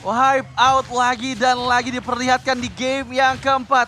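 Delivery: loud booming play call; hype commentary. Real, not acted. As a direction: boom it out over the crowd. Co-caster calls play loudly.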